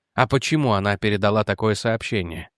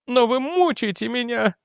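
high, clutching-chest anguish; having a heart attack; 11 semitones higher than usual. Acted. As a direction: gasp it out as the heart seizes. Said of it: "Она схватилась за грудь". (хватаясь за сердце)

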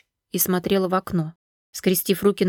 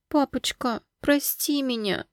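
high, soft frightened plea; timid acted tremble; trembling voice; 5 semitones higher, 4 dB quieter, slower than usual. A narrator's reading. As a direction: beg father's forgiveness in a small voice. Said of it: "дрожащим голосом произнесла".

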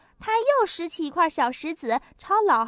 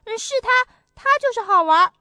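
put-on high, loud accusing kids; children pointing him out; 7 semitones higher, 8 dB louder than usual. A narrator's reading. Children accusing the boy to the old monkey, high and loud. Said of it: "小朋友们指着好玩儿说".

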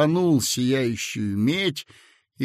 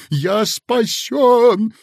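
much higher, louder, slow; ecstatic shout; 10 semitones higher, 8 dB louder, much slower than usual. A narrator's reading. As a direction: burst out in ecstatic relief. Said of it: "ликуя воскликнул". (восторженный крик)

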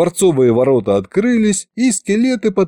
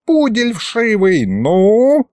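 high-pitched angry name-calling; angry tirade; 7 semitones higher, slower than usual. Acted. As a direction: berate the prisoner, drawing out the insults in a raised pitch. Кот ругает и допрашивает пленника.

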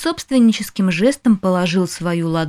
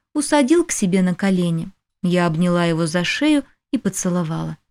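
plain reading voice

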